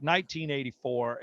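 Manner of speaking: usual speaking voice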